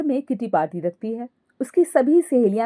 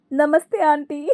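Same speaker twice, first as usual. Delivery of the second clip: higher greeting bursting into shrieking cackles; greet, then lose control laughing; 7 semitones higher than usual. Acted. Daughter-in-law greeting mother-in-law's friends, laughing. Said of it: "Ankita हंसते हुए बोली".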